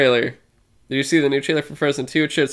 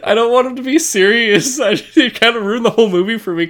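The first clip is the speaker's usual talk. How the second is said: laughing; 10 semitones higher, 5 dB louder than usual. Real, not acted. Laughing hard about Jonah Hill.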